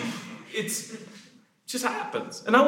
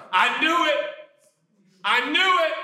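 smug told-you-so; 8 semitones higher than usual. Acted. Gossips judging the bitten.

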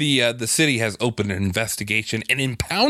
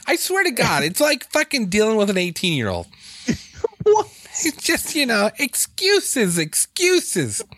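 exasperated scolding; 11 semitones higher than usual. Real, not acted. Scolding late cohost.

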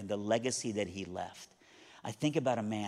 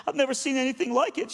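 high-pitched overwrought woman, imitated; emotional; 12 semitones higher, 5 dB louder than usual. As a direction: play the tearful cleaning lady, voice up high. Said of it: "I'm sorry to be emotional".

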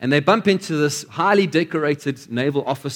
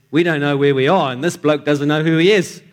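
loud Australian sailor punchline; Australian accent; 5 dB louder than usual. Acted. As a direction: deliver it loudly as a hammed-up Australian mate. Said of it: "try my best Australian accent".

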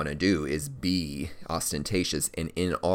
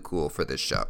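ordinary delivery

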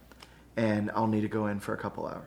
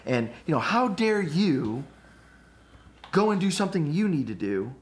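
pissy indignation; 4 semitones higher, 3 dB louder than usual. Retold or imitated. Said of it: "I'll get all pissy about it".

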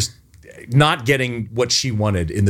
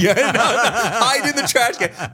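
laughing; 10 semitones higher, slower than usual. Real, not acted. Retelling absurd hiding order.

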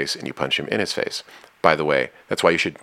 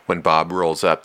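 ordinary talk, nothing marked

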